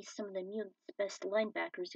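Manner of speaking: usual speaking voice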